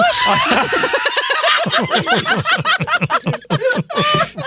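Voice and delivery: long high laughing fit; helpless shrieking laughter. Real, not acted. Laughing hard at joke.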